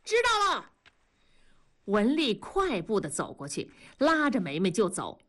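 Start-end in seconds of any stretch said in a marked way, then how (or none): 0.0-0.7 put-on very high child's voice; boy answering. A narrator's reading. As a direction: call back like an obedient little boy. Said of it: "狗子答应着". (小男孩高声答应)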